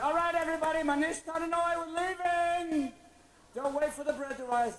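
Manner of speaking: loud, high call to the crowd; rallying shout. Acted. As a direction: herd everyone out, yelling.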